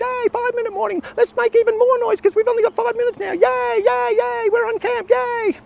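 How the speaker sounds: put-on squeaky high, cheering boys; rowdy excited kids. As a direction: whoop it up like a kid at camp. Boys cheering before lights out.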